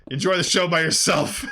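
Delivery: hammed-up sidekick taunt; taunting Zorak and Moltar. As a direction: jeer at him as you leave. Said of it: "Zorak and Moltar are like".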